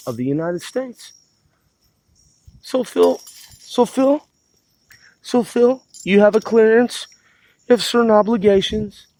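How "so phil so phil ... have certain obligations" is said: raised, higher scolding of Phil. Acted. Lecturing dead Phil about his clearance, higher and louder. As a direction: talk down to him like a scold.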